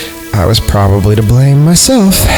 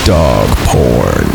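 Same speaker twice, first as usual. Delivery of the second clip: dropped far down in pitch; low, slow punchline after a pause; deadpan reveal; 9 semitones lower than usual. A narrator's reading. Narrator answers his own question, deadpan and low.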